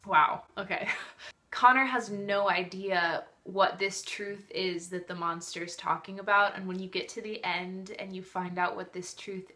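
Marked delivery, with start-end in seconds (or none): none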